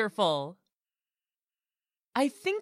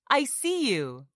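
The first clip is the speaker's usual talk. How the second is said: ordinary talk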